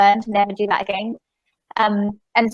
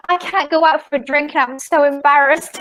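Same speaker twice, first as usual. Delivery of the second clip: higher-pitched, mock-distressed quote; upset; 5 semitones higher than usual. Friend too embarrassed to go for a drink, mock upset. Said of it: "She was so upset".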